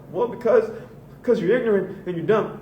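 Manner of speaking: high, loud, whiny sneer; hammed-up indignant jeer. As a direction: mimic a defensive bigot. Racist answering his question.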